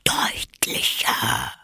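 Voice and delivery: low, very slow whispery voice; stern, sleepy caterpillar. A narrator's reading. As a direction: demand it slowly, half whispered. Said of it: "fragte die Raupe streng". (streng, tief und schläfrig gehaucht)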